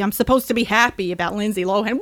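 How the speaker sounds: usual speaking voice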